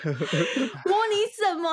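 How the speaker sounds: high, slow, drawn-out disbelief; wife asking in shock; incredulous question. Retold or imitated.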